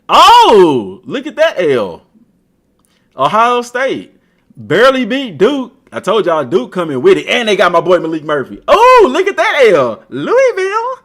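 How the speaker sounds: loud, high gleeful taunt; rising glee at each loss; gleeful gloating. Real, not acted. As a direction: crow over their losses. Gloating over a rival's loss.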